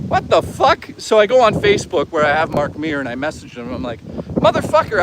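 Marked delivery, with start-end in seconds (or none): 0.1-0.9 shocked. Retold or imitated. Shocked reveal, retelling own reaction. 4.0-5.0 excited. Retold or imitated. Messaging Mark Meer in disbelief.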